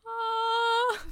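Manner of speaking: a high, loud imitated cat scream; mimicking the cat screaming at her in the morning. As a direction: belt out a sudden cat yowl.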